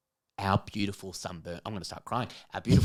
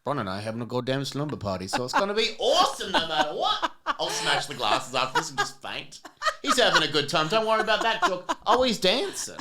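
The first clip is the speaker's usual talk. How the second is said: laughing; 7 semitones higher, 3 dB louder than usual. Real, not acted. Laughing at dancing guest.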